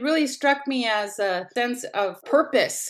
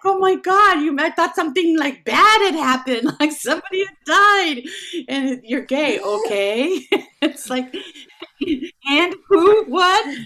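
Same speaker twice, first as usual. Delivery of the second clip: high loud laughing rush of questions; laughing loud disbelief and eager questions; excited relief; 8 semitones higher, 8 dB louder than usual. Retold or imitated. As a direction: gush with relief and curiosity. Retold excited reaction.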